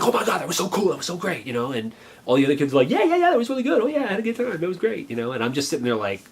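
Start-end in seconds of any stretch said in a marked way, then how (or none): 0.0-1.5 high, fast excited gushing; imitated gushing kid. Brother gushing after the movie. 2.9-5.1 very high-pitched eager chatter; imitated excited kids. Other kids chiming in excitedly.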